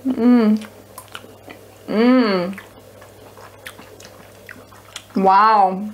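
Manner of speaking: talking while eating; mouthful of food; chewing with mouth full. Real, not acted. Seen 0: Woman takes sausage from fork, chews with full mouth while reacting.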